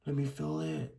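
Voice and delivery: very soft, searching for the feeling; barely voiced, unconvinced; quiet and hesitant; tentative. Shown as a demonstration. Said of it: "and then, like".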